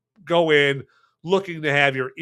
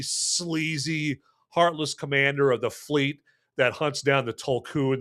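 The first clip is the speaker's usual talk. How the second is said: ordinary talk, nothing marked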